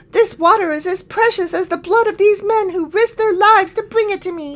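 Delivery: high, prissy lament. Acted. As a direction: whine it like a pampered king. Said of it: "he's got a prissy voice".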